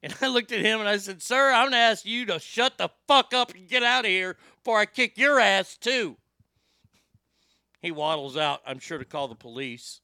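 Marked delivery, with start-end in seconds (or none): 1.2-6.2 raised, forceful mock-threat to the boss; angry comeback. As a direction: tell him off loudly, punching the curses. Retold confrontation with her boss.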